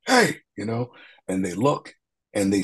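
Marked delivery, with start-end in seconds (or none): 0.1-0.4 sharp, high, loud call across the street; loud hey. Retold or imitated. Officer calling out to kids on the roadside, a sharp loud hey. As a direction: bark it out to stop the boys. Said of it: "I got a deep voice and I'm like".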